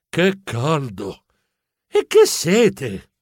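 slow, drawn-out thirsty sigh; slow parched moan. Acted. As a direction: drag the words out, dying of thirst. Parched mouse narrator exclaims.

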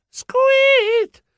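very high, sharp "squeet"; high mouse squeak. Acted. Mouse finds training is over, high squeak.

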